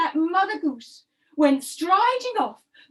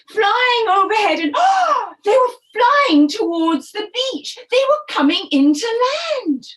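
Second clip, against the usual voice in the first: building excitement; 5 semitones higher, 7 dB louder than usual. A narrator's reading. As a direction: build the wonder as the geese arrive. Geese appear, narrator builds excitement.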